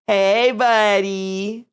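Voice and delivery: high, very slow doting greeting; greeting the cat. Real, not acted. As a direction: stretch it out sweetly for the cat.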